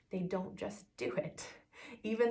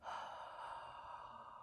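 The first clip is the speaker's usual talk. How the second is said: fogging-a-mirror exhale; soft open-mouth sigh; 14 dB quieter than usual. Shown as a demonstration. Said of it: "you go".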